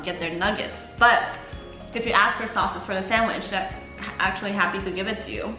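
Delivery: usual speaking voice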